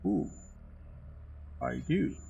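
normal talking voice

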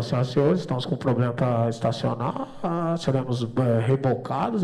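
low, loud pilot announcement voice; piloto de avião. Retold or imitated. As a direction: make a deep captain's announcement, dragging the end. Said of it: "Aí o piloto falou". (voz grave de comandante anunciando)

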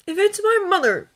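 high, loud snap; snapped. A narrator's reading. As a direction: bite the words off in anger. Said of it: "Draco snapped".

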